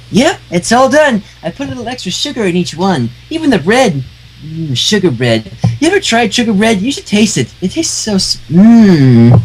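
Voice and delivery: high, bouncy cartoon pony voice; high, giddy pony chatter; bubbly Pinkie Pie. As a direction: chirp it like an overjoyed pink pony.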